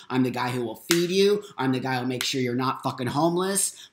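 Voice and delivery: normal talking voice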